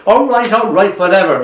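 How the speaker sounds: exasperated. A narrator's reading. Memory answers the poet's nitpicking, exasperated. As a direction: concede it with an eye-roll in the voice. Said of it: "Memory rolls its eyes".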